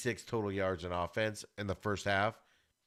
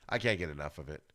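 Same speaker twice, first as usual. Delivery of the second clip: ordinary talk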